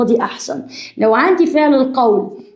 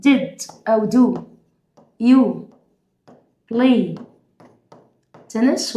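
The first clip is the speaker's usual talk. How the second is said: slow dictation; 3 semitones lower, much slower than usual. Shown as a demonstration. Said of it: "اكتب ورايا".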